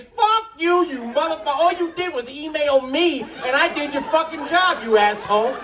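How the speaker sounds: comic outburst against teachers; mock angry rant. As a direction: rant with exaggerated fury.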